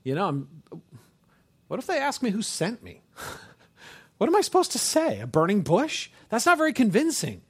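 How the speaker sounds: high-pitched, hesitant mock protest; Moses. Preacher acting Moses doubting his mission, timid and flustered. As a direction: start halting, then climb into freaked-out objection. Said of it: "Moses is a little timid here".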